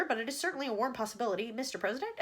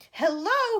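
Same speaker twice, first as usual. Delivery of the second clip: loud high yell; 9 semitones higher, 9 dB louder than usual. A narrator's reading. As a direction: yell into the receiver. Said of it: "he yelled".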